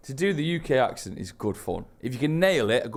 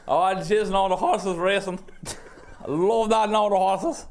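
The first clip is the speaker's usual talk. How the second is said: put-on drawn-out, higher stage-Irish accent; 5 semitones higher, slower than usual. Podcaster riffing on horse racing, hammy Irish accent. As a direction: ham up an Irish racing fan. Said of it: "I can't do an Irish accent".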